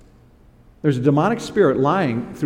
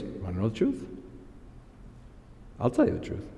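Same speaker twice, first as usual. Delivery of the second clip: much softer, low and serious; grave truth; 4 semitones lower, 12 dB quieter, faster than usual. Retold or imitated. Micaiah turning serious before the king, soft and grave. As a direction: drop the voice, deadly earnest. Prophet finally speaks seriously.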